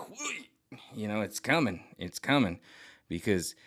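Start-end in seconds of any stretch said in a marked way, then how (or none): 0.1-0.5 much higher, soft playful whee; gleeful whee. Retold or imitated. Brushing off the leeches, a high soft gleeful whee. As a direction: squeal like a kid on a slide. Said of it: "we're just gonna be like".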